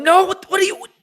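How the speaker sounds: high, loud yell of protest; retold yelling. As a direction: yell in alarmed disbelief, reenacting it. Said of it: "I'm yelling".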